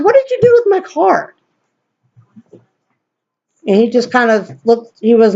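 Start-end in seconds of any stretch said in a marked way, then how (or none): 0.0-1.3 very high, indignant question; acted-out heated accusation; retold angry demand. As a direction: confront him, voice pitched way up. Retold confrontation with Miguel.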